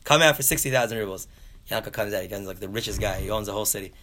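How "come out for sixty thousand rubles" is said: loud, high burst on the first word; acted-out exasperated surrender; exasperated giving-in. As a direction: cave in loudly and grudgingly. Gives in to Yankel's price.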